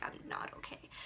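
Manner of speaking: barely audible unvoiced whisper. Answering mom in the car, near tears, whispered. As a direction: breathe the words out with no voice. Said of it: "And I'm like".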